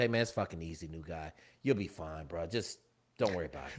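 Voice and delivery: low, soft, offhand murmur; casual reassurance. Retold or imitated. Teammates calming new guy on the bus, low and casual. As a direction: brush the worry away quietly.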